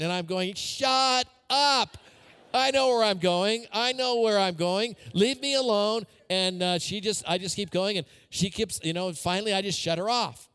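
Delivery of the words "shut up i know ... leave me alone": higher, louder, playfully exasperated snapping back; mock annoyed retort. Preacher telling the congregation how he snaps at Siri, hammed-up annoyance. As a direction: pretend to yell at the nagging GPS.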